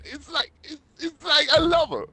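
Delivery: very high, rising to a crying shout; New York guy. Shown as a demonstration. New York guy gushing about his girlfriend, near crying. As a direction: overwrought New York guy, voice cracking with love. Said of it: "now a New York guy".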